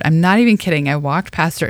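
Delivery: ordinary talk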